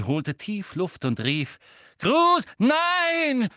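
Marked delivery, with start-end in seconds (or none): 2.0-3.5 greller, sehr hoher Schrei; schreiend mit gebrochener Stimme. A narrator's reading. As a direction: schrei verzweifelt und laut dazwischen. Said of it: "schrie Hermine mit gebrochener Stimme". (verzweifelter hoher Aufschrei)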